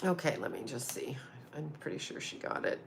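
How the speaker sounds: very soft, unvoiced whisper to yourself; whispered self-talk. Real, not acted. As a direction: whisper under your breath while checking something. Muttering to self while checking message.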